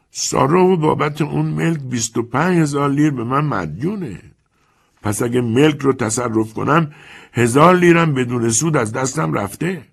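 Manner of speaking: put-on a calm, sly old lender talking down to the young man; old moneylender Chiarenza. Chiarenza answers calmly.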